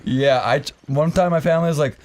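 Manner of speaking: ordinary talk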